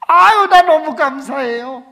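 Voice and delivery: high, loud, slow cry of thanks; overjoyed deacon. Retold or imitated. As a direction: gush with joy over being fired. 해고된 집사의 기쁨. (높고 크게 천천히 기뻐하며)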